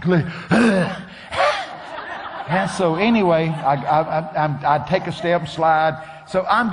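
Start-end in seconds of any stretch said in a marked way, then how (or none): 0.3-2.4 imitated panting. Preacher mimics himself climbing the mountain, panting. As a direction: heave out worn-out breaths. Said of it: "I'm breathing like a".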